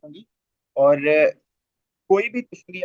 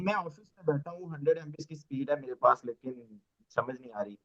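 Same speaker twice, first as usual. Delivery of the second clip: faint breaking call audio; bad connection; 9 dB quieter than usual. Real, not acted. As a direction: voice fading away on the line. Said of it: "नहीं समझ में आ रहा आप क्या बोल रहे हैं".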